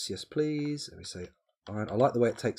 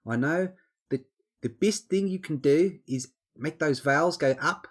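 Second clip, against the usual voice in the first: higher, louder put-on Kiwi accent; New Zealand accent; 6 semitones higher, 5 dB louder than usual. Shown as a demonstration. Streamer demonstrating how Kiwi vowels go up, New Zealand accent. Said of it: "do a New Zealand accent".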